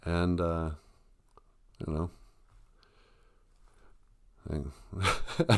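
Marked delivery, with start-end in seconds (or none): none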